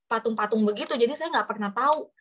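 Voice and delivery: ordinary talk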